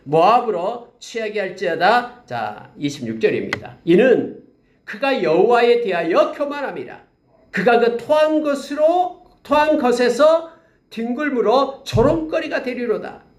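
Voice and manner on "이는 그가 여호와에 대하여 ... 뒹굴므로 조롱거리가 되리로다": raised, solemn Bible reading; scripture reading. Acted. Pastor reading scripture to his church, solemn and raised. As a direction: proclaim the verse to the congregation. Pastor reads Jeremiah 48 aloud.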